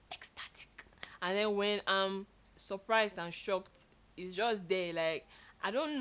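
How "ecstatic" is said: soft breathy gasp of delight; gasping, ecstatic. Shown as a demonstration. Showing how her face gives away excitement with a gasp. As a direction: gasp like something thrilling just happened. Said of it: "when I'm super excited, I'm all like".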